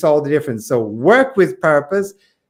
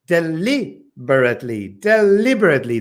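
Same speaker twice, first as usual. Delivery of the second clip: "Deliberately" with stress on li. Shown as a demonstration. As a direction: hit the li in "Deliberately". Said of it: "It goes on the li".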